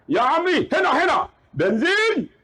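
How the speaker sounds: high, emphatic mock call; urgent insistence. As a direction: imitate a driver desperate to be understood.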